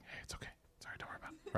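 hushed, whispered brotherly reassurance; whispered comforting. Retold or imitated. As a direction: whisper consolation, imitating a brother. Brother consoles in the other room.

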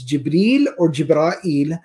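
Jibril as jib-REEL, Jibra'il as jib-raa-EEL; contrasted pronunciations. Shown as a demonstration. Teacher recapping both forms, Jibril then Jibra'il with extra syllable. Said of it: "how exactly are they pronounced".

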